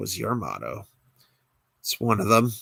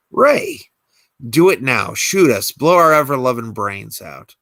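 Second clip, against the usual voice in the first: defiant shout; 2 semitones higher, 7 dB louder than usual. Acted. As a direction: shout the dare right in her face. Defiant dare at gunpoint.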